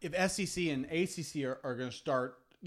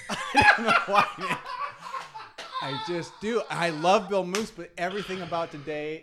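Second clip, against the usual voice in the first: giggling through the words, trying not to laugh; breaking up with laughter mid-sentence; 6 semitones higher, 8 dB louder than usual. Real, not acted. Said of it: "I wanna laugh".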